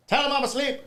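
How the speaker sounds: high mimicked holler from another room; calling out. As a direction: imitate someone yelling out an excuse. Star calls out from RV room.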